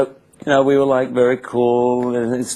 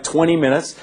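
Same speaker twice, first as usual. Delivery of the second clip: usual speaking voice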